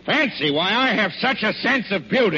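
villain protests to Santa he has taste; indignant. Acted. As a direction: bristle at the insult, pitch up.

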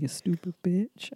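low, soft imitation of a lover; quiet, intimate mock put-down; whispered insult. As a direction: murmur it low, like pillow talk. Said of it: "He whispered in my ear".

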